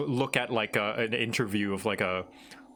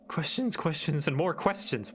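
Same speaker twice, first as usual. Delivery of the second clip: high, lilting voiced character; lightly voiced fantasy character; 4 semitones higher than usual. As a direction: read the line as a bright game heroine. Voicing game character lines.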